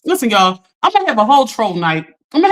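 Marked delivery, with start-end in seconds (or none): none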